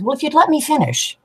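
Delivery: put-on high, huffy kid voice cutting mom off; little girl's voice. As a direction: deliver the punchline as an indignant five-year-old. Joke punchline, little girl voiced.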